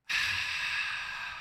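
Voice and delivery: slow breath out; long unvoiced sigh. Real, not acted. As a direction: exhale softly and long. Deep relaxing breath out.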